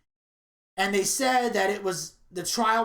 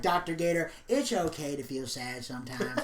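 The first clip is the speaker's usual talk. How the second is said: mock soothing doctor; 4 semitones lower, 4 dB quieter than usual. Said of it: "Gator was doing the whole".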